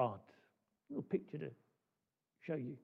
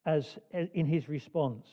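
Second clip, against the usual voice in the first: ordinary talk, nothing marked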